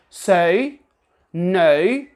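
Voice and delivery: so and no with a pure British O. Shown as a demonstration. Teacher repeats the British O words. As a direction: say so and no with a complete O. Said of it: "words like".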